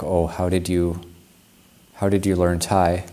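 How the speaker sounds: ordinary talk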